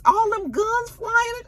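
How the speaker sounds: slow, very high lament; mock wailing. Fan laments Monet facing guns, high mock wail. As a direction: wail it in hammed-up dismay. Wailing over character's death.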